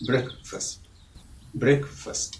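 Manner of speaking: "ബ്രേക്ക്ഫാസ്റ്റ്" said softly as BREK-fust; short vowels in both halves of "ബ്രേക്ക്ഫാസ്റ്റ്"; correct pronunciation. Shown as a demonstration. Teacher giving learners the right word, softly pronounced brek-fust. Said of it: "അല്ല, പിന്നെയോ".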